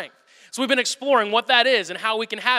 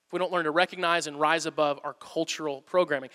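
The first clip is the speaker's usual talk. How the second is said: softer, weighty repeat; 5 semitones lower, 6 dB quieter than usual. Shown as a demonstration. Pastor repeating the quote to his church, softer and deliberate. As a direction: repeat the line softer, leaning on "recognize".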